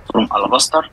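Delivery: ordinary talk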